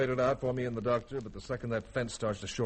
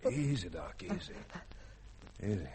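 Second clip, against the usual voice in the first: soothing; 3 semitones lower, 6 dB quieter than usual. Acted. Calming injured doctor.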